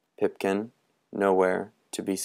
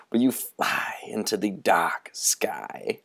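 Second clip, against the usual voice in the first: eerie, hushed whisper; 3 dB quieter than usual. Acted. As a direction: draw them in with a secret. Moundshroud ends in a hush.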